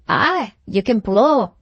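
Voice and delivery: slow, grudging admiration; grudging praise. Acted. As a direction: give him the compliment slowly, half unwilling. Grudging praise of rival's piping.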